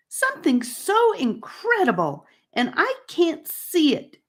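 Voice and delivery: high, bitter complaint; fuming. A narrator's reading. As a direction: stamp your feet and fume. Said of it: "Charlie fumed and stomped his feet".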